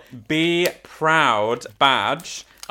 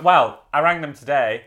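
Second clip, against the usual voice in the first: ordinary talk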